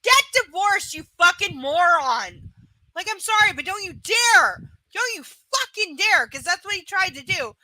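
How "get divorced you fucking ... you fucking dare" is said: raised, louder angry rant; indignant, forceful telling-off; real anger. Real, not acted. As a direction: tear into them, higher and emphatic. Rant aimed at absent client.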